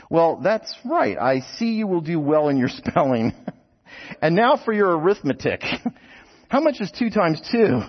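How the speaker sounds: high-pitched, condescending examiner; examiner's voice. Acted. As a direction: play the interviewer, pitched well up. Examiner patronizes the patient youth.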